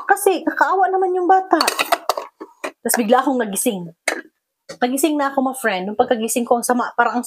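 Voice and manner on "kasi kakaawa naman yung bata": high, louder pleading insistence; urgent plea. Retold or imitated. To the family opposing adoption, a high urgent plea. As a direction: beg them to feel pity, pitched up.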